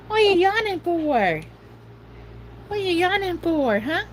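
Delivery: high teasing pet voice. Real, not acted. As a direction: tease the dog up high. Teasing dog about yawn.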